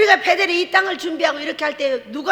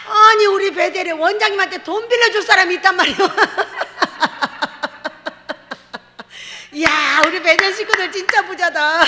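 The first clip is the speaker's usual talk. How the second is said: amazed exclaim; 4 semitones higher, 4 dB louder than usual. Retold or imitated. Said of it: "뭐라 했는지 아세요?".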